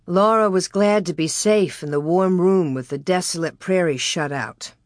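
plain reading voice

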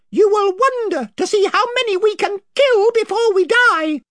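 high, proud little mouse knight; Reepicheep. Acted.

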